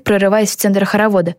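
ordinary delivery

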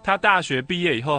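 normal talking voice

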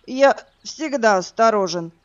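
put-on hiccuping drunk reply. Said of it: "икнув, ответил Влад".

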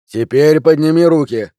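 loud, firm order; police command. A narrator's reading. Policeman ordering the suspect to raise hands, loud.

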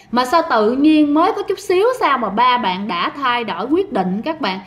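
plain reading voice

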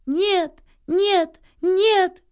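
high, loud repeated cries; all-out shout. A narrator's reading. Nils shouts down at a courting thrush, full-voiced yell. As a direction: shout each no with all your might. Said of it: "закричал Нильс, что есть мочи".

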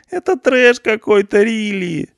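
very high, despairing whine; hopeless groan. A narrator's reading. Punk moans hopelessly in his cell, high despairing whine. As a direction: give up all hope. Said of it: "Панк застонал безнадёжно".

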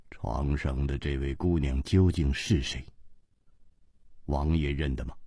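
low, quiet, grave question; low steady voice. Acted. Chu Liuxiang calmly asks the king who the dead girl is, low and quiet. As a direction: ask it calmly, deep and measured. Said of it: "沉声说".

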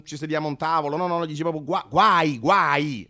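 ordinary delivery

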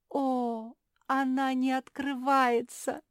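high, loud, drawn-out wail; nearly crying. A narrator's reading. Seamstress finding the box shut, nearly crying. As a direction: wail in dismay, on the verge of tears. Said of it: "чуть не заплакала".

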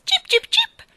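three high, loud cheeps; shrill little bird chirps; chirping bird. Acted. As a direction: pipe it like a skylark. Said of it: "ripeté".

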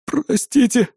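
high, frightened apology; frightened exhale. A narrator's reading. Engineer apologising at gunpoint, frightened. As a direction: breathe out a scared apology. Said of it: "трясясь от страха, выдохнул бедолага".

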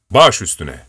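usual speaking voice

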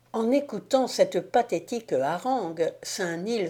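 ordinary delivery

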